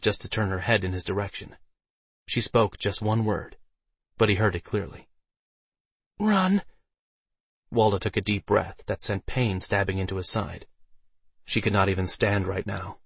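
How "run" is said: high-pitched pained female voice; injured Alice. A narrator's reading. Hurt Alice to Waldo, urging escape, pained. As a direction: push out one word, high and strained.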